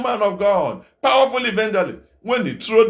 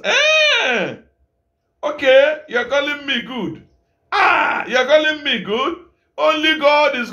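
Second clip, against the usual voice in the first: high, mock-surprised challenge; incredulous Jesus; 5 semitones higher, slower than usual. Jesus questions the man who called him good, high and incredulous. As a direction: toss the compliment back in disbelief.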